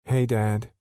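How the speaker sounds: put-on high little-girl greeting. Addie greeting her father Derek, high little-girl voice.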